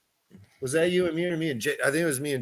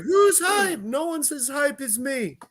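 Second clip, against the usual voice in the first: hype shout; 11 semitones higher than usual. Retold or imitated. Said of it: "she was like".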